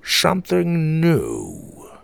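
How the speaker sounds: slow musing in a grandpa voice; slow pondering. A narrator's reading. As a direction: stretch it out as you puzzle over it. Grandpa ponders the feather slowly.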